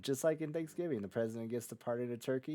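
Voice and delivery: ordinary talk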